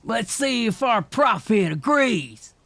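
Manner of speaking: slow, high, loud shout; Paul shouting. A narrator's reading. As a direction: shout it slowly, threatening. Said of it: "Paul growled roughly".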